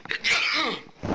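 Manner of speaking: one sudden sharp sneeze; sneezing. Real, not acted. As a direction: let a real sneeze burst out. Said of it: "I'm gonna sneeze here".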